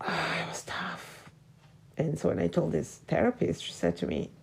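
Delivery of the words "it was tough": sighing whisper. Real, not acted. Sighing after a hard memory.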